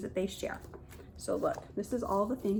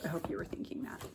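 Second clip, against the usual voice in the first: whispered aside; 3 semitones lower, 9 dB quieter, much faster than usual. Real, not acted. Aside after the big reveal.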